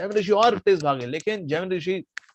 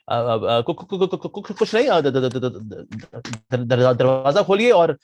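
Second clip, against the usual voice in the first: halting stammer that bursts into a louder ask; stammering request; 2 semitones lower, 4 dB louder, slower than usual. Acted. Jaimini stammering at the girl to open the door. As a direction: trip over the sounds, then push it out.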